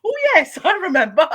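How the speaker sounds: high and loud through laughter; laughing recall. Retold or imitated. Guest laughing at her forgotten sentences, loud and high. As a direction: laugh it out, remembering. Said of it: "I was like".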